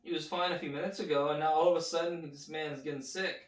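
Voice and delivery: usual speaking voice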